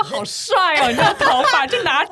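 high, loud gushing, breaking into laughs; mock praise through laughter. Mocking praise of Xi in a WeChat message, laughing. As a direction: gush sarcastically while cracking up. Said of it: "故意在微信里面就一直夸赞习近平".

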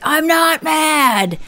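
high, drawn-out mock denial; hammed-up indignant man; defensive anger. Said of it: "men don't wanna be perceived as angry".

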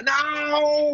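high-pitched mock yell of protest; drawn-out mock holler; retold yell. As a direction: imitate a protesting father yelling, without full volume. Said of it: "começou a gritar".

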